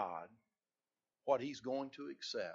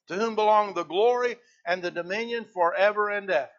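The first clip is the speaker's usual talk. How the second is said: louder, higher, emphatic sermon delivery; full-force preaching; 6 semitones higher, 13 dB louder than usual. Acted. Preacher driving home reverence for God, fervent. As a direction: preach it out to the congregation.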